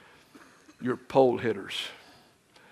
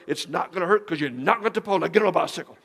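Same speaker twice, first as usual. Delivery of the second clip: fast, louder and higher urging; pushy dad; 4 semitones higher, 5 dB louder, faster than usual. Retold or imitated. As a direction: hurry her onto the bike. Father urges daughter.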